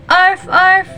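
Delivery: high playful arf arf; dog bark. Shown as a demonstration. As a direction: bark like a puppy. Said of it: "Can you say it again?".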